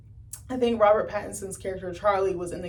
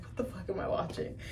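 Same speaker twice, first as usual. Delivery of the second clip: muttered disbelief; 10 dB quieter than usual. Retold or imitated. Bewildered viewer aside.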